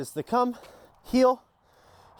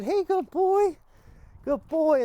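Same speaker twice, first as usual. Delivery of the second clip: very high, eager praise; excited praise; 12 semitones higher, 3 dB louder, slower than usual. Shown as a demonstration. Trainer gets the dog excited before the down, very high praise. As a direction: lift your voice way up to excite the dog. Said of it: "get him excited".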